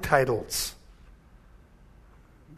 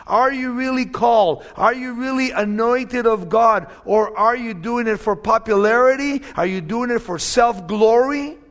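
loud, pressing rhetorical questions; preaching at full force; 4 semitones higher, 5 dB louder than usual. Acted. Pastor warning would-be teachers, preaching loudly. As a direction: challenge the false teachers from the pulpit.

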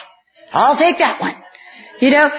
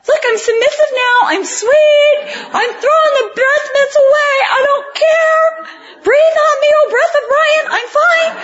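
much higher, sugary mock-submissive voice; mock sweet submissive wife; 12 semitones higher than usual. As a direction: play the meek, sweet wife, hammed up. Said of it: "I'm looking at him like".

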